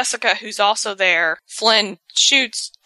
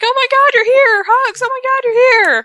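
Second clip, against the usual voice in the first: very high, loud excited gushing; gushing squeal; 7 dB louder than usual. Retold or imitated. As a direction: squeal with delight at seeing them. Gushing reunion quote.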